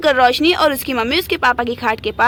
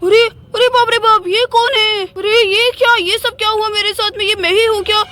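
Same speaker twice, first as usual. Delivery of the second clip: startled, higher-pitched alarm; shocked; 5 semitones higher than usual. Acted. Father seeing long hair in mirror, shocked. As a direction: recoil in disbelief at the sight.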